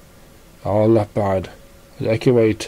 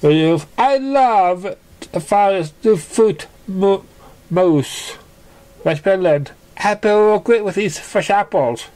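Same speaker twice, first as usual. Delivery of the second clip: Surprise; 9 semitones higher, slower than usual. A narrator's reading. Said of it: "so surprised".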